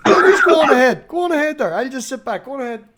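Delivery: mock sulk. Mock sulking, laughing through it.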